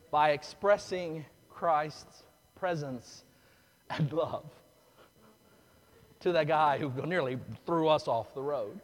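ordinary talk, nothing marked

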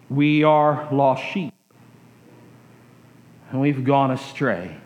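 a hushed, slowed retelling of "We are lost sheep". Acted. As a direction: lay it down gently, like a confession. Repeated sermon line, slowed and softened.

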